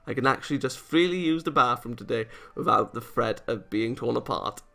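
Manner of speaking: normal talking voice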